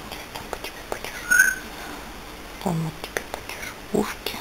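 soft whisper to a pet. Real, not acted. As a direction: hush it gently to the dog. Soothing the dog closely. (шёпотом)

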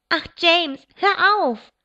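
high, loud exasperated shout. A narrator's reading. Charity on the stairs shouts at her kicking brother, loud and high. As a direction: yell at the squirming boy to stop. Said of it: "schrie Charity".

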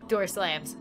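usual speaking voice

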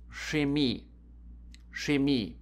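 "Chemie" said as shay-MEE; careful "Chemie", sh at the start; ch as sch. Shown as a demonstration. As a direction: show "Chemie" starting with a sh sound. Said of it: "C H can be pronounced like S C H".

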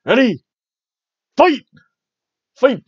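high, loud arcade announcer call; booming fighting game announcer. Pretend. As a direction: bark out the round start. Fighting game round start call.

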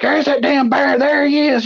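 put-on an old lady losing it at the damn bear; hollering old lady. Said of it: "I would holler".

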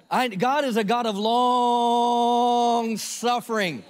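slow, loud, drawn-out proclamation; slow proclaimed declaration. Real, not acted. A preacher declaring God's patience to the church, slow and loud. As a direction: stretch every word out, preaching hard.

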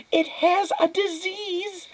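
very high, drawn-out shriek; slow, piercingly high outburst; screamed alarm. A narrator's reading. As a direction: cry out in horrified warning. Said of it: "screamed her mother".

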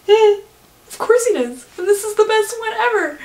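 high, loud, smug mock pride; showing off the husband with a high smug lilt; proud wife. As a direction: gloat over your man, hammed up. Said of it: "I was just like".

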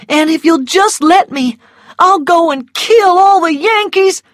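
put-on high, loud boy's vow; fervent young son. A narrator's reading. Phil begs his mother to let him fight, fervent.

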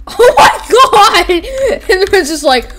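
ordinary talk

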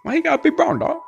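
loud, very high mock complaint; high-pitched whine. Real, not acted. Player protesting to the brown door, high-pitched whine. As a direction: squeal it in playful protest.

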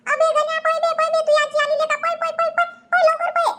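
put-on a high child's voice yelling at his friend to run; a shrill kid's panic, run run run; urgent warning call. Friend urges Ganya to run.

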